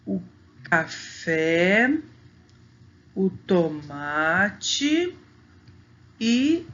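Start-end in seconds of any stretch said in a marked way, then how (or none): 0.6-2.0 "café" drawn out with a strong open final "fé"; "café" with the last syllable rising and held; stress on final syllable. Shown as a demonstration. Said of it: "a sílaba mais forte". 3.1-5.2 "o tomate" very slow, stretching "ma"; "tomate" drawn right out on its middle syllable "ma"; stress on penultimate syllable. Shown as a demonstration. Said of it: "a sílaba que a gente fala mais forte".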